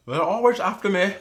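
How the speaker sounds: laughing mock Irish leprechaun line; bad Irish accent. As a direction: giggle through a hammed-up Irish tagline. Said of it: "when you do a bad Irish accent".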